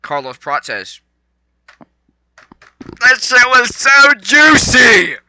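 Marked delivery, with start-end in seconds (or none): 3.0-5.2 high, slow, drawn-out yell of delight; real hyped shout; excited shout. Real, not acted. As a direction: scream it like the best thing you ever saw. Hyped reaction to a fight.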